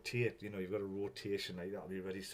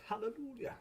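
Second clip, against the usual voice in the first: high-pitched hallelujah and hey; mock celebration; 13 semitones higher than usual. Real, not acted. Streamer relieved the broadcast works, celebrating.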